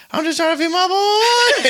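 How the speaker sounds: very high, loud, laughing put-on voice; imitating viral line. Friend from New York quoting TC's viral clip, laughing. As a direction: squeal the catchphrase through laughter.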